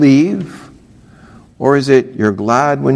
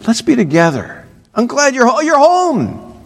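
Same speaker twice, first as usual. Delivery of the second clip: high, happy greeting; glad welcome; 10 semitones higher than usual. Pretend. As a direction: welcome her home gladly. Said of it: "but rather".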